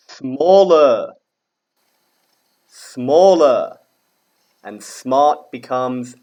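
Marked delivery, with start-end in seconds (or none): 0.1-3.8 loud, higher, drawn-out falling word; model for repetition. Shown as a demonstration. Teacher models the comparative for pupils, loud and clear. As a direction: call it out broadly for the class to echo.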